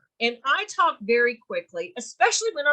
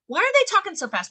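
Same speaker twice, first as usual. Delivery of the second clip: suspicious questioning; 7 semitones higher, much faster than usual. Acted. Voicing client's suspicion of fast talker.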